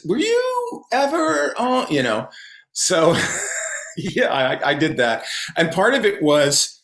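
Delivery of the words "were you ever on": high, drawn-out fan's question; slow, high-pitched mock inquiry; curious fan. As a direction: voice a tentative fan asking, pitched way up. Voicing fans who recognize him.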